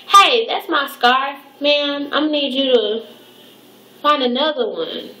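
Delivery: mock the demanding customer lightly; bossy customer.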